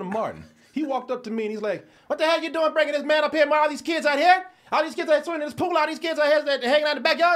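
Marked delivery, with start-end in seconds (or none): 2.1-7.3 loud, high, furious father scolding his son; angry father yelling. Retold or imitated. Father chewing out his son about a guest, angry yell. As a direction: bark it like an enraged dad.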